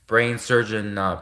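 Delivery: normal talking voice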